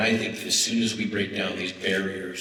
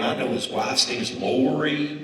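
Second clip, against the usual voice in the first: higher, hammed-up Southern lady; bragging in a put-on Southern accent; bad Southern accent; 5 semitones higher, 4 dB louder than usual. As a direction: play a loud Southern lady one-upping her friend. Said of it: "Bad accent".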